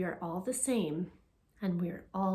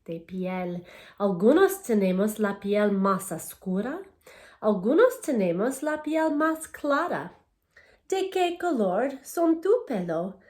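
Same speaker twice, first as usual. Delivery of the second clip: louder, clear storybook reading to a class; reading to children; 6 dB louder than usual. Acted. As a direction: read each page out to the kids, bright and paced. Reads Spanish picture book aloud.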